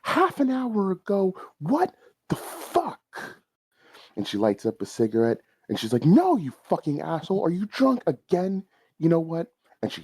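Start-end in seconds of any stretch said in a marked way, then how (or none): 0.0-3.4 high, angry phone yell. Acted. McDonald's girl yelling into her phone. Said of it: "she's just yelling into it". 6.1-9.5 high, heated scolding; angry phone rant. Acted. McDonald's girl berating a drunk caller.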